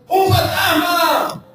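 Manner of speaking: high, slow cry of alarm; frightened cry. A narrator's reading. Disciples in the boat crying out in fear. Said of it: "se turbaron diciendo".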